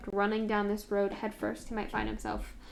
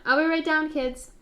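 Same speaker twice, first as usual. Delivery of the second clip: calling down; 7 semitones higher, 9 dB louder than usual. Acted. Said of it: "Philza called back down".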